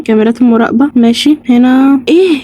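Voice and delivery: ordinary delivery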